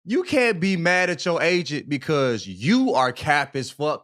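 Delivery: usual speaking voice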